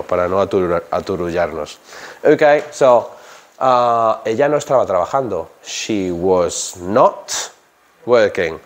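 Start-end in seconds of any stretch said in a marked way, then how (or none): 5.6-8.6 not stretched slowly with a rise; stress on not. Shown as a demonstration. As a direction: drag out not for learners. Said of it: "not, not, not". (NOT alargado y marcado)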